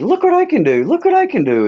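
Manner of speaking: put-on very high squeaky kid voice; high excited child voice shouting for attention; Stewart impression. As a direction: bounce around like a hyper child showing off. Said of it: "that Stewart character".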